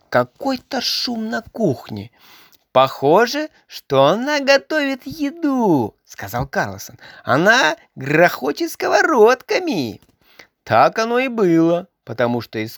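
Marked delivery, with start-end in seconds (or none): 2.7-10.0 a higher, bouncy storybook Karlsson; lightly voiced cheeky Karlsson. A narrator's reading. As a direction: read him lively and higher. Karlsson talking to the boy.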